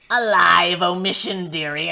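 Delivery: crone voice. Acted. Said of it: "Grandmother Crow says".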